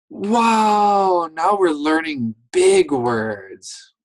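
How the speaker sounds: high, loud, amazed wow; excited wow. Acted. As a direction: act thrilled about big words. Excited teacher, new page.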